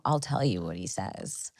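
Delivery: low, very soft murmur; hushed aside. Retold or imitated. Confiding to sister while holding the phone, hushed. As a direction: drop way down, confidential.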